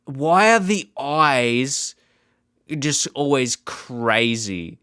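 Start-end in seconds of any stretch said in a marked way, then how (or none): none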